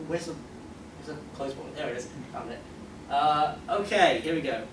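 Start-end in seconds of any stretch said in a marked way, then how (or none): none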